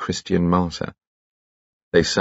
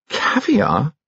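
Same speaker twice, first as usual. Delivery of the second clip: high, rising surprised cry; delighted surprise; 13 semitones higher than usual. Acted. Troy at tea, surprised by caviar, delighted. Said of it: "said Troy".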